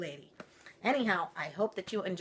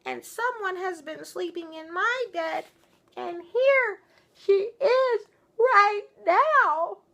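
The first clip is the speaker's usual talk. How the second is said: put-on much higher, louder excited baby bear; wee little voice; 11 dB louder, slower than usual. Baby Bear finding Goldilocks in his bed, high and loud. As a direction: squeal it out as he finds her. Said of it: "in his wee little voice".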